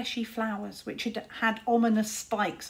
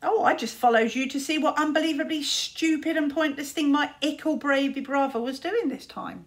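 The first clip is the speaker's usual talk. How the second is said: smug mocking; 5 semitones higher, 5 dB louder than usual. Smug Luta mocks Tsar.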